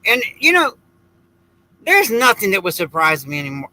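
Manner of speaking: ordinary talk, nothing marked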